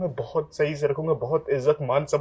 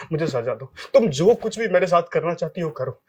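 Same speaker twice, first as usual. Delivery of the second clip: ordinary talk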